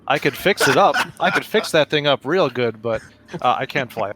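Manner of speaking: ordinary talk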